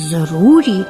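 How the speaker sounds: usual speaking voice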